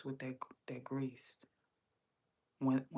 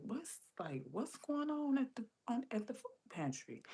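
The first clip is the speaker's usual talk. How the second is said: bewildered questioning; 10 semitones higher than usual. Retold or imitated. Acting out her bewilderment.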